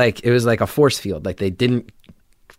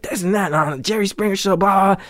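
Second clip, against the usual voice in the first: higher ranting mock yell; mock screaming rant; 8 semitones higher, faster than usual. Driver rants about the Jerry Springer show, high mock rant. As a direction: rant on and on, half-mocking. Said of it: "this guy just screaming at me".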